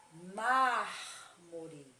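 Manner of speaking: Mármore with a loud, long "Már"; stress on first syllable. Shown as a demonstration. Teacher showing pupils a proparoxytone, stress on the "Már" of Mármore. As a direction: punch and stretch the start of Mármore. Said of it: "será a antepenúltima sílaba".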